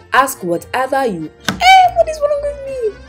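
ordinary talk, nothing marked

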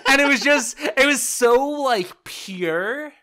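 excited. Real, not acted. Excited about the goal call.

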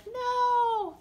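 high, long drawn-out wail; bird's dismayed no. Acted. Voicing poor Bird's face to the children, high mock dismay. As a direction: voice the poor bird, high and stretched. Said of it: "she's like".

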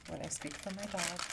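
usual speaking voice

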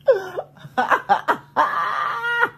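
high, loud burst of laughter. Real, not acted. Vlogger bursts out laughing at her own mess.

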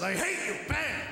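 "I hate you" snapped high, then a sharp "bang!"; hammed-up teacher venting, then the bang; mock angry teacher. Teacher yelling at the hyper boy, mock furious. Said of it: "She says".

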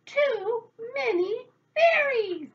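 very loud, high, drawn-out wail; despairing shout. A narrator's reading. Old woman fleeing too many fairies, long high shout. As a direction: holler it word by word, stretched out. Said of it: "shouted the old woman".

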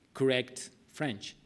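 usual speaking voice

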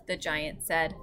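usual speaking voice

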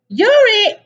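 high, loud playground cry; excited tag-game shout. A narrator's reading. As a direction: call it out mid-chase. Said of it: "cried a cod".

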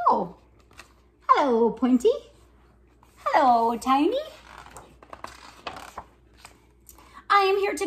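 lively picture-book reading with voices for each character; reading to children. Acted. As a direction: read it like story time for little kids. Reads picture book aloud.

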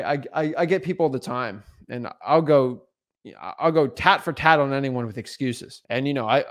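normal talking voice

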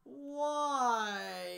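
loud, high anguished No and Why; anguished cry. Retold or imitated. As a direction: cry out like Glenn in grief. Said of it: "I felt like Glenn in that moment".

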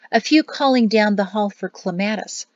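usual speaking voice

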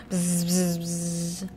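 low, soft bzz; soft low buzzing; buzzing bees. Acted. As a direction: buzz like bees.

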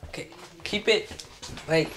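ordinary delivery

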